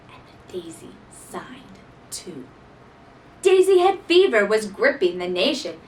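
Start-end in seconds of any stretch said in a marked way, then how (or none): 0.1-2.5 soft whispered reveal. A narrator's reading. Narrator whispers that the daisy signed too. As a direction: whisper it like a secret.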